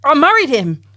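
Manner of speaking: put-on clumsy try at an Australian accent. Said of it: "I can't do an accent".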